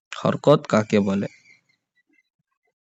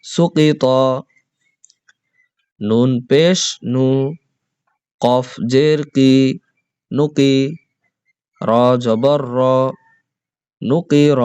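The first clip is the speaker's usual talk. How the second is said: teacher's chanted letter-by-letter recitation; spelling drill. Shown as a demonstration. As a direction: spell out each syllable for the class to repeat. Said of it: "এখানেও ঠিক একই রকম উচ্চারণ হবে".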